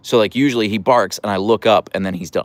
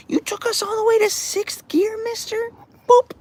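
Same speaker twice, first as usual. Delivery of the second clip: high, cooing pet voice; 3 dB quieter than usual. Real, not acted. Owner coos at her dog Archer in high baby talk. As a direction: coo at the dog in a much higher voice.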